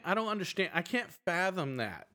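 normal talking voice

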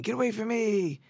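high, loud frantic yelling; wife screaming at her father-in-law. Acted. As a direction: scream to drive him out. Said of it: "she starts screaming".

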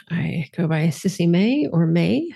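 usual speaking voice

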